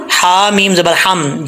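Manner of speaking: ordinary talk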